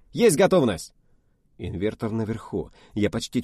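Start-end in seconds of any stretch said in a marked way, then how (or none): 0.1-0.9 high, loud snappy report; brisk reply. Acted. Officer reporting to the test leader, loud brisk reply. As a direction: rap it out smartly.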